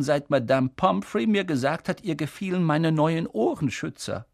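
a hint of an amused old headmaster, lilting; lightly voiced Dumbledore, modest and playful. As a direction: lightly play the bashful, wise wizard. Narrator voices Dumbledore in dialogue. (leicht gespielter, verschmitzter Schulleiter)

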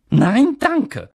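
crisp, cool no; cool refusal. A narrator's reading. McGonagall declines Dumbledore's sweet, coolly. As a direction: brush the offer aside coldly. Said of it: "sagte Professor McGonagall kühl".

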